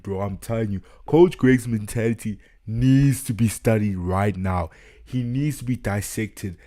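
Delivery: loud, exasperated ranting. Real, not acted. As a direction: rant loudly in disbelief. Ranting about Coach Greg.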